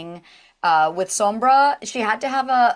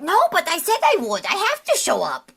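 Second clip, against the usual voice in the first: much higher, insistent Raze voice; earnest Raze; 11 semitones higher, 3 dB louder than usual. Acted. Raze insists to friends she'll come even sick, high and earnest. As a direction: she promised, she'll show up. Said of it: "Ray's is like".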